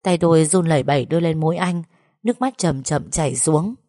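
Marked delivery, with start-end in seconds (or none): none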